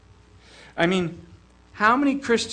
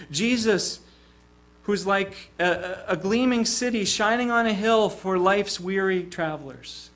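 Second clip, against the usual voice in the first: ordinary talk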